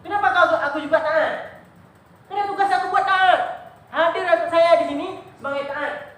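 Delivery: high, loud pleading insistence; fervent teacher. Acted. Teacher asks why he still obeys, loud and fervent. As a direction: press each question with conviction.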